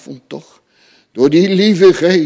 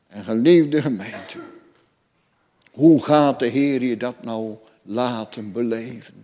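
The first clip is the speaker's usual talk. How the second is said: ordinary delivery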